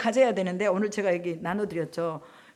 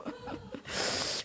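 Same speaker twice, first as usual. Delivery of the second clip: unvoiced high laugh; 11 dB quieter than usual. Real, not acted.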